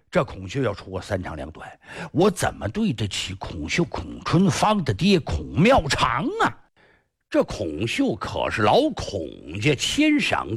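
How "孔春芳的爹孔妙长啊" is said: usual speaking voice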